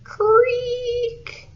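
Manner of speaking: very high, long squeaky creak; voiced imitation of a high creak; creaking door. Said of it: "His door went".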